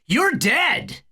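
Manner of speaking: high, angry outburst; furious cry at a little brother; yelled. A narrator's reading. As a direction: throw it out as a high-pitched threat. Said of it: "Harry yelled".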